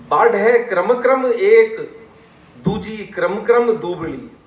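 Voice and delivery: loud, high, slow verse; sortha recitation. Shown as a demonstration. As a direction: declaim it in a measured beat, drawing out the words. Recites verse between explanations.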